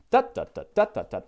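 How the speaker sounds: chanted dactyls, first "dot" of each three punched; beating out meter. Shown as a demonstration. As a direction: tap out the rhythm with the voice, DOT dot dot. Demonstrates dactylic meter in syllables.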